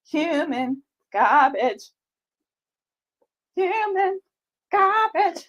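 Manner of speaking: high strained human garbage; high falsetto quote. Retold or imitated. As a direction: squeeze it up high. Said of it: "my other soundbite".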